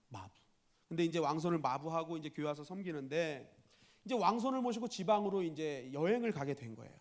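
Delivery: ordinary delivery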